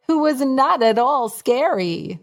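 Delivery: ordinary delivery, nothing marked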